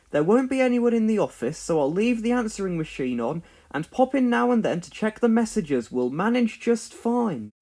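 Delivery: a lightly voiced, upbeat builder reassuring his team; cheerful Bob. A narrator's reading. Bob telling the team not to worry, lightly voiced and cheerful. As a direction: keep it bright and confident, like a can-do boss.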